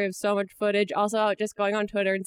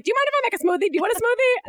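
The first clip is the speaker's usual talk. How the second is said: high imitating voice; 10 semitones higher, much faster than usual. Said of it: "and then was like".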